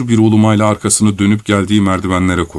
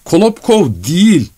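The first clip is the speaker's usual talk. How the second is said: high-pitched, exasperated correction; impatient cry; 7 semitones higher than usual. Acted. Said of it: "diye haykırdı sabırsızca Korotkov". (sabırsızca haykırarak)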